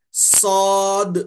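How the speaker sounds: صاد held long with a loud whistling hiss; whistling sibilant. Shown as a demonstration. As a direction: stretch صاد into a sharp whistle. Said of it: "سیٹی کی سی آواز نکلتی ہے". (سیٹی جیسی آواز کے ساتھ صاد)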